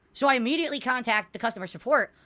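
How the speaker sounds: ordinary talk, nothing marked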